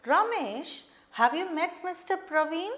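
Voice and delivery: "Ramesh" set high, "have you met Mister Praveen" lifting at the end; rising question tone. Shown as a demonstration. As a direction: ask it with the voice going up on "Praveen". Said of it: "your tone goes up".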